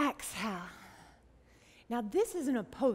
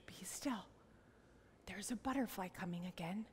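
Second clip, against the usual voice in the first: soft whisper, much quieter; hushed; 4 semitones lower, 14 dB quieter than usual. Pretend. Teacher warns children a butterfly is coming again, hushed. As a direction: hush them so nothing is disturbed.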